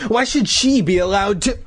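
high, indignant complaint; demanding. A narrator's reading. Boy protesting to his mother, demanding. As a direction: demand it with pitch pushed up. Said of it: "Todd demanded".

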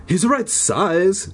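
slow, emphatic excited delivery; Zeke's voice. A narrator's reading. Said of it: "Zeke continued".

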